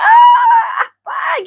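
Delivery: shrieking crying fan, very high; mock a hysterical fan scream; imitating a screaming fan. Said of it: "jadi kayak ... gitu loh".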